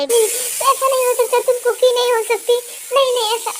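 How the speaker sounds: high panicked denial; high frightened no; terrified denial. Acted. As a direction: cry out in terror. Rama denies in terror.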